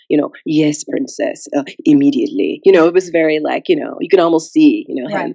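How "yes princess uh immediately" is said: sophisticated servant. Retold or imitated. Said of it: "the older European man was a very sophisticated servant".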